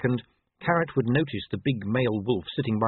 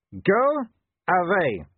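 high, loud, forceful dismissal; cold rebuff; 10 semitones higher, 5 dB louder, much slower than usual. A narrator's reading. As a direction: send the pest packing. She-wolf rebuffs the dog.

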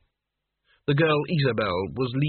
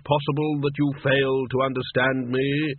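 stern Death. Acted.

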